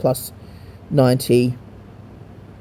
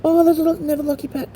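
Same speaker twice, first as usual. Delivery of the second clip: much higher squeaky voice reading the message; mock chat voice; faster than usual. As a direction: read the game's message in a silly squeaky voice. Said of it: "It just tells me".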